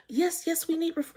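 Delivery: high, hurried insistence from Peach; high mimicked clerk cutting in; mimicking Peach. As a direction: mimic the clerk pushing back.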